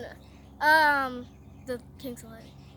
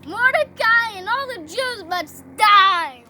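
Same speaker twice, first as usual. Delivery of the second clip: loud, higher, drawn-out villain rage; angry; 4 semitones higher, 12 dB louder than usual. Acted. Haman raging at Mordecai, loud and angry. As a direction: declare it in hammed-up fury.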